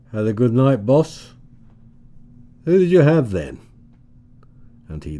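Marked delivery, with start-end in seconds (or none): 0.1-3.6 high, loud teasing leer; salacious leer. A narrator's reading. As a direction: needle him with a dirty grin. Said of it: "Louis inquired salaciously".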